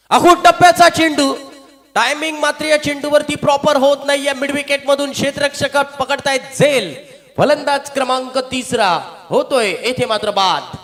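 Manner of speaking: loud, high shouting commentary; excited wicket call. Real, not acted. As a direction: erupt as the catch is taken. Wicket falls, commentator erupts. (उत्साहात ओरडून समालोचन)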